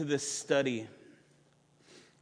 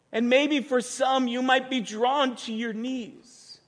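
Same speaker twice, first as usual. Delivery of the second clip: higher, urgent pastoral plea; impassioned call to worship; 7 semitones higher than usual. Acted. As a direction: urge the congregation to respond.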